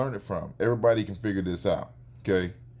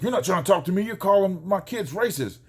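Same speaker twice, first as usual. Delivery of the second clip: imitating an irate neighbour, higher pitched; retold angry yelling; 8 semitones higher than usual. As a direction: act out an indignant accusation. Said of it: "started yelling and screaming".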